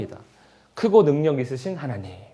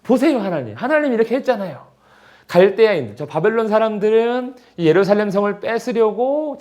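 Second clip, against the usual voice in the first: higher, louder urgent plea to God; Jeremiah pleading; 7 semitones higher, 4 dB louder than usual. Acted. Preacher voicing Jeremiah's protest to God, urgent and raised. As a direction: press God like a bewildered prophet.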